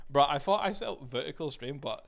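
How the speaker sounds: loud complaining to chat; frustrated shout. Real, not acted. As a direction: call out the setup failure. Stream setting still wrong.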